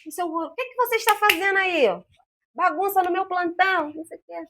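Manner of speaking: high, loud scolding; an annoyed doctor telling the room off; stern doctor. Retold or imitated. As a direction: tell them off for the mess on her ward. Doctor scolds the noise on her shift.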